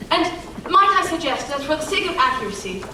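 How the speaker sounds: ordinary talk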